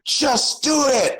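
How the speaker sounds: high, slow, drawn-out shout; Shia LaBeouf. Retold or imitated. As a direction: yell it like a motivational rant. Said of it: "do a Shia LaBeouf".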